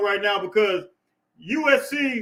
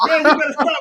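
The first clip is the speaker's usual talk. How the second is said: laughing while scolding playfully; 5 semitones higher, 6 dB louder than usual. Real, not acted. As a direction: tease them through a laugh. Laughing again at chat.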